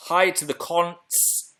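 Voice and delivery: cautious, drawn-out greeting; careful enunciation. Real, not acted. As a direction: say it slowly so it can't be misheard. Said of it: "Had to say that carefully".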